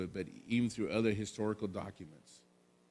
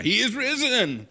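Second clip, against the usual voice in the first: much higher, louder, slow call of he is risen; loud proclamation; 14 semitones higher, 12 dB louder than usual. Shown as a demonstration. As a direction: call it out to the congregation. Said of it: "Oh, come on now".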